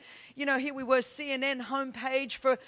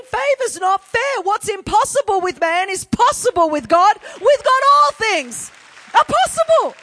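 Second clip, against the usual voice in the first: soar up in triumphant joy; jubilant cry; 7 semitones higher, 12 dB louder than usual. Retold or imitated. Preacher crying out her joy on takeoff.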